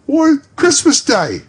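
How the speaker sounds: put-on high, loud boy's voice; a surprised urchin; startled young lad; street boy. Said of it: "replied the boy".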